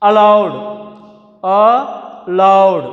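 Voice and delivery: Allowed, LOWED said loud; stress on LOWED. Shown as a demonstration. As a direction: punch the end of Allowed. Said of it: "pronounce loudly".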